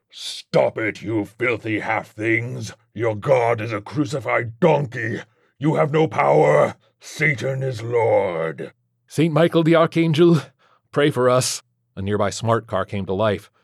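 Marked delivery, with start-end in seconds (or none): 0.1-8.7 slow, drawn-out demonic blasphemy; raging, drawling demon voice; enraged demon Legion. Acted. 9.1-11.6 higher, louder saint's invocation; raised-voice prayer in battle; urgent litany. Acted. Exorcism litany over the chaos.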